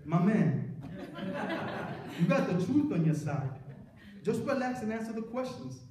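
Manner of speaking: put-on light Bronx accent, laughing through it; a hint of a New York street voice. Friend reassuring him in court, light Bronx accent. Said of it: "I'm gonna try to do his little accent".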